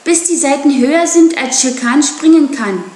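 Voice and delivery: calling out. Acted. Mowgli calling to Grey Brother over the herd, loud. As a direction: shout it over the running herd. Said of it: "rief Mowgli".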